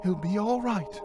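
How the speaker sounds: high ghostly reassurance; high-pitched ghost woman, insistent; insisting spirit. A narrator's reading. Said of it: "the voice insisted".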